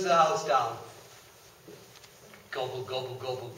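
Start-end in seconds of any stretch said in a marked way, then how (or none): 2.5-3.5 low, soft greedy gobble; softly gobbled up; quiet gobbling. Acted. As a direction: mutter it like munching. Wolf eats the pig.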